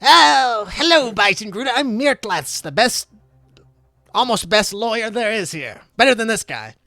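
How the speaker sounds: high, showy lawyer voice; self-important attorney, loud opening; boastful lawyer. Acted. Game master voices lawyer Mirtlatz.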